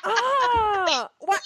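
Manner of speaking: high squealing "Oh my God", half laughing; embarrassed shriek. Real, not acted. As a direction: shriek in mock horror at the topic. Embarrassed by episode title.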